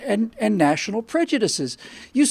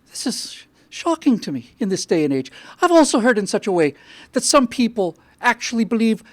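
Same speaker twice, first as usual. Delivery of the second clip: Irish priest; 4 semitones higher, 4 dB louder than usual. Acted. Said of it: "with an Irish accent".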